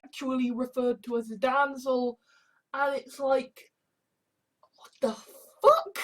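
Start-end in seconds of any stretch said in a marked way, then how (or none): 4.8-6.0 pause, then high, loud final word; acted bafflement. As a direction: let the last word leap up in disbelief. Acted reaction after "it's like.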